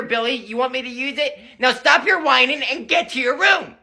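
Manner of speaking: normal talking voice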